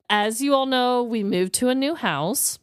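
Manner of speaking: ordinary talk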